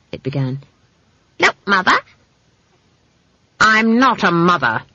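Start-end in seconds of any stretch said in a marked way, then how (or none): 1.4-2.0 high-pitched, tentative appeal; placating hunter. A narrator's reading. Nervous hunter addressing the trapped witch, placating. 3.6-4.8 raised, indignant snap; snapped. A narrator's reading. Witch correcting a hunter, snappish. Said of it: "snapped Granny".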